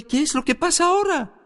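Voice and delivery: mock exasperation at yet another interruption; exasperated question. Preacher interrupted again while praying for people, exasperated question.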